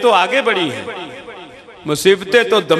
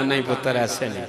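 put-on old woman softly insisting no, dropping to a near hush; low and soft, gently refusing to believe; hushed refusal; 6 semitones lower, 9 dB quieter, faster than usual. Old mother gently refusing the captive lady, hushed and low.

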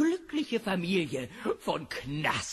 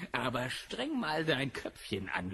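usual speaking voice

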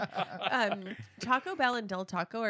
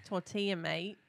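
Australian tortilla with mate tacked on; a light Aussie twang; Australian accent played for laughs; mock Australian accent; 6 dB quieter, slower than usual. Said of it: "say it with your Australian accent".

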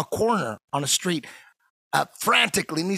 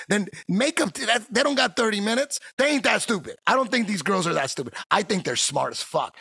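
ordinary talk, nothing marked

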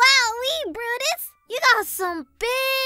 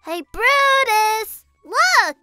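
loud, drawn-out call across the yard; 3 semitones higher, 6 dB louder, slower than usual. Real, not acted. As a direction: call his name from far away. Calling out to her friend.

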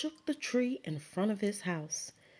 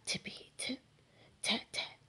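soft whispered tippy tip tat tat; whispered rain sounds; 4 semitones higher, 6 dB quieter than usual. Acted. Light rain rhythm whispered.

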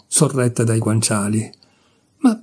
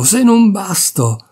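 higher, slow, exasperated repeat; exasperated outburst; 7 semitones higher, slower than usual. Acted. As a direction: drag it out, at the end of her rope. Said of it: "Alla fine proruppe".

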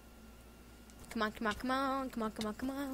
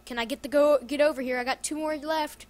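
usual speaking voice

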